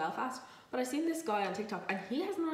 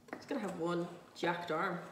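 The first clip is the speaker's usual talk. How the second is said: ordinary delivery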